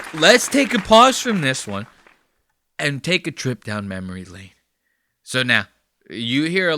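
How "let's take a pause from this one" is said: loud, high announcer voice again in earnest; big announcer call. Acted. Host repeating the pause call to viewers, loud announcer voice. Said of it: "repeated announcement".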